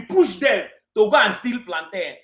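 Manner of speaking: normal talking voice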